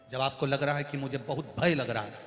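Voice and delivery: usual speaking voice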